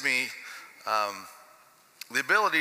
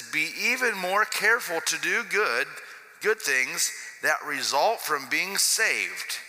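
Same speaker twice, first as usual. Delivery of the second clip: slow, deliberate Bible reading; scripture reading; 5 semitones higher, slower than usual. Acted. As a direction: weigh every phrase of the verse. Reads Living Bible aloud.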